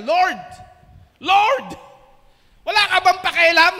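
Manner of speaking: loud, high, fast cries of Lord; frantic shout. Acted. Martha shouting at Jesus from the kitchen, frantic. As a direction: yell it, exasperated and desperate. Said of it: "Sabi niya".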